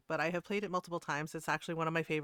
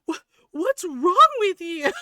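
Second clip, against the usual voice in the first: high, loud mock shriek, drawn out; crashing out; 11 semitones higher, 12 dB louder, slower than usual. Streamer imitating the man crashing out, high drawn-out yell. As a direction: imitate a man completely losing it. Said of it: "he was crashing out hard".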